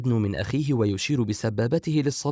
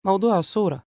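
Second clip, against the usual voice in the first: high, louder irritated retort; narrated flash of nervous anger; tense and angry; 9 semitones higher, 5 dB louder than usual. A narrator's reading. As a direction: snap it in a raised woman's voice. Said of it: "بخليط من التوتر والغضب".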